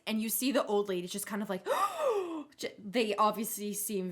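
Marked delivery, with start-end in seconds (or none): 1.7-2.5 shocked gasp. Acted. Old ladies shocked at insult.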